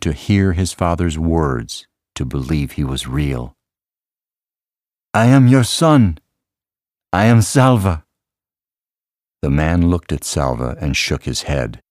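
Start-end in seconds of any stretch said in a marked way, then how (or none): 5.1-8.1 earnest declaration. A narrator's reading. Salva reveals himself to father.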